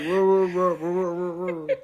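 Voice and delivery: garbled wordless imitation of bad audio; unintelligible muffled mumble. Co-host mimics No Love's bad microphone, garbled mumble. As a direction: mimic a badly miked actor as muddy noise. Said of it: "then No Love would talk again".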